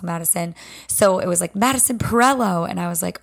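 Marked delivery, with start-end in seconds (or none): none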